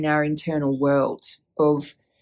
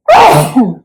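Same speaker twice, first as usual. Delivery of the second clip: sharp real cough; one loud cough; 3 semitones higher, 13 dB louder than usual. Real, not acted. Loud cough after sneeze.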